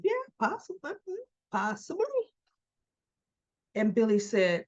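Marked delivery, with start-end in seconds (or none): 0.0-2.3 very high, softened teasing voice; put-on high, cagey hinting; coy admission. Acting Ashley coyly admitting scheme.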